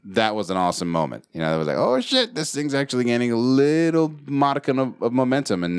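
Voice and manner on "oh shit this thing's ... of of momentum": higher-pitched, amazed realisation; retold excitement. Host recalls the show's first hundred listens, retold excitement. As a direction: relive the thrill in a raised voice. Retells his excited reaction to success.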